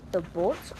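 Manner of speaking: ordinary delivery, nothing marked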